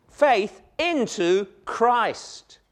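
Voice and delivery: drawn out, "Faith" stressed; very slow emphasis. Shown as a demonstration. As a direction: spell out "Faith into Christ" slowly. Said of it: "a bit more emphatic".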